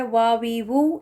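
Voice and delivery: وا وی وو said with rounded lips, w not v; w vowel sounds. Shown as a demonstration. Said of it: "واو زبر زیر پیش کے ساتھ". (ہونٹ گول کر کے وا وی وو)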